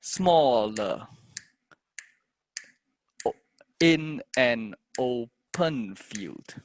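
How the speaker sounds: very slow metered chant, syllables held on the beat; poem in three. Shown as a demonstration. Teacher trying to fit a meter to verse, slow measured recital. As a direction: count it out in three, stretching stressed syllables. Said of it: "maybe in three".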